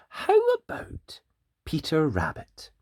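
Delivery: very high, slow teasing lilt; high sing-song. Acted. Host teasing the children with a high sing-song. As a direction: stretch it up high like a playful tease.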